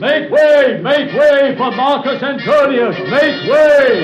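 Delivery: high, loud proclamation clearing a path; herald's call. Acted. Herald crying out to clear the way, loud. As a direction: cry out to clear the way for the general. Herald announcing Antony's arrival.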